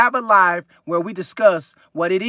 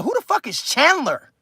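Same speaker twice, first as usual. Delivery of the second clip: incredulous question; 5 semitones higher than usual. Retold or imitated. Recalls asking about the toy name.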